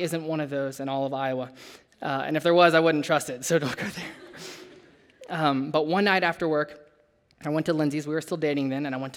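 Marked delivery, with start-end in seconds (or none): 3.4-5.4 slow breathy chuckle through the words; laughing aside. Real, not acted. Preacher joking with the congregation, laughing it off. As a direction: laugh it off slowly. Joking about Iowa oysters.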